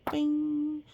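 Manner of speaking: ordinary delivery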